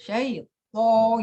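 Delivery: "Syaitho" drawn out slowly with a very thick to; exaggerated tebel to; heavy to. Shown as a demonstration. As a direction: weigh down the to of Syaitho. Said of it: "to-nya itu tebel banget".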